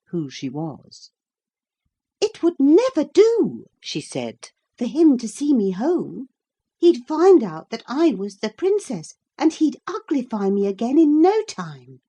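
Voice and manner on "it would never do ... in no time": put-on high, louder urgent girl; worried princess. A narrator's reading. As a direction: warn him in a rush.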